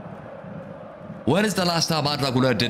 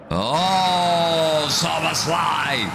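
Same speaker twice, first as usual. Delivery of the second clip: high, drawn-out cry of the scorer's name; excited goal cry; 7 semitones higher, much slower than usual. Real, not acted. Streamer hailing a Liverpool free-kick goal, excited cry. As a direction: soar up high and stretch out the name.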